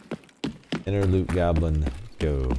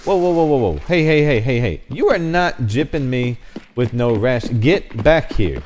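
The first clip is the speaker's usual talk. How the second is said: loud, high calls to stop; shouting after him; 8 semitones higher, 8 dB louder than usual. Real, not acted. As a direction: yell after the fleeing Santa. Santa runs away, calling out.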